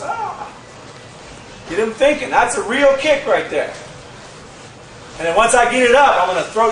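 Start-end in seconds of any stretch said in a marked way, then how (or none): 0.0-0.5 mock surprised gasp. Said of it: "So he goes".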